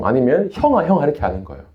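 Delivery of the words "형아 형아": high-pitched mock-cutesy Seoul speech; higher, sugary imitation; cringey cutesy address. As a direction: imitate a cloyingly sweet call to an older brother. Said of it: "너무너무 오글거려서".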